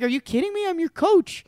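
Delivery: high, disbelieving outrage; a coach who can't believe it; shocked coach protesting; indignant coach. Retold or imitated. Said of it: "the guy's like".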